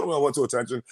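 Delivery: normal talking voice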